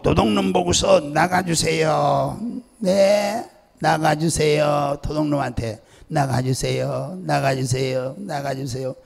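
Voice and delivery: slow, drawn-out begging that tapers off; singsong mock plea, repeated and fading; mock polite pleading. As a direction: parody a helplessly courteous request. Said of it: "도둑놈한테 하고 있으면".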